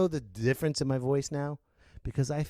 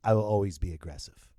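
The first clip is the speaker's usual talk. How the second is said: ordinary talk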